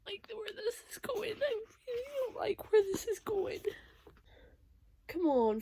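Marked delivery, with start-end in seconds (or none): 0.0-1.3 whisper. Real, not acted. Reader dreading the plot. 1.4-3.6 near tears. Real, not acted. Reader upset by the book.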